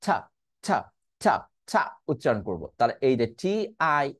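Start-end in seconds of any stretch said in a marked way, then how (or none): 0.0-1.9 ছা pushed out with a strong puff of air; aspirated t. Shown as a demonstration. Teacher showing how to push air on ছা. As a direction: blow ছা out with a burst of breath. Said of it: "ধাক্কা দিতে হবে, বাতাস দিতে হবে".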